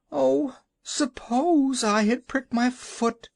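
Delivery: high, slow tearful wail. A narrator's reading. Woman wails her fear to the Shaggy Man. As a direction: wail it out in dread. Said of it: "she wailed".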